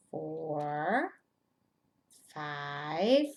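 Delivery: ordinary talk, nothing marked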